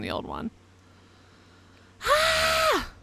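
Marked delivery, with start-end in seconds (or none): 2.0-2.9 high, sharp cry of frustration; frustrated yelp. Real, not acted. Player stuck on the game's saving, exasperated yelp. Frustrated outburst while playing game.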